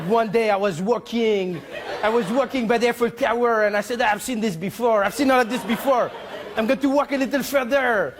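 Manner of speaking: put-on French accent, storytelling. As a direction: play a French traveller with a thick accent. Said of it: "your accent".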